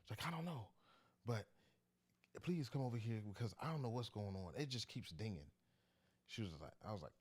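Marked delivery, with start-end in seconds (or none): 0.2-5.5 high pleading mock mom voice; imitating anxious mom. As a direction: beg like a flustered mother, pitch up. Son retells mom's plea.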